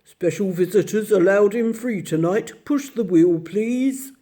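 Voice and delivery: a lightly voiced gruff bear gatekeeper; a hint of a deep gruff doorman. Bear at the gate welcoming the children, lightly gruff. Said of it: "said a deep, gruff voice".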